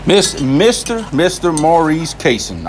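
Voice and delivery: Maurice Kaysen said slowly, syllable by syllable; slow, careful pronunciation of "Maurice Kaysen"; careful name reading. Real, not acted. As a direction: spell out "Mister Maurice Kaysen" carefully, unsure of it. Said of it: "I'm assuming I'm saying it right".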